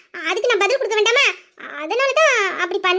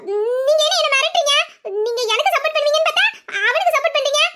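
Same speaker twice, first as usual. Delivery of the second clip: indignant; 5 semitones higher than usual. Acted. Indignant at husband's threat to tell.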